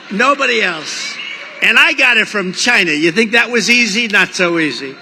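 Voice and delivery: ordinary talk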